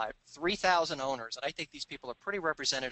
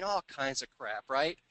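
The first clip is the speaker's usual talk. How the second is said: ordinary talk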